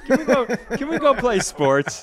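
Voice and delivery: high laughing whine; laughing plea. Real, not acted. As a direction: giggle while pleading to go. Laughing begging to leave.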